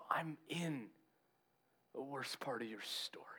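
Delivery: very soft, whispery answer; gentle reassurance. Retold or imitated. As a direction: hush it tenderly, as acted comfort. Jesus gently answers anguished Peter.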